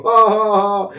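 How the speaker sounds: high taunting ha-ha laugh; mock laugh. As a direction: imitate the friend cackling at him. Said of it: "him laughing and laughing".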